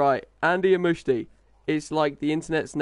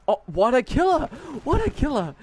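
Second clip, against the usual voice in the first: dismayed; 12 semitones higher than usual. Real, not acted. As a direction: yelp in disbelief at the mistake. Accidental kill in game.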